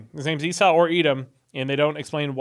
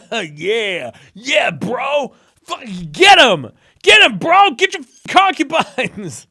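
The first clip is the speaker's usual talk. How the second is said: loud mock bro cheer, pitched way up; hype cheering; 7 semitones higher, 8 dB louder, much slower than usual. Mock bro hype over a Bible verse, loud and high. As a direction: yell like a hype man egging a bro on.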